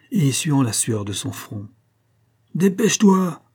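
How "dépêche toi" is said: much higher and louder furious command; an impatient master roaring at his groom; angry shout. A narrator's reading. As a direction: yell it at the hesitant servant. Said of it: "cria-t-il avec colère".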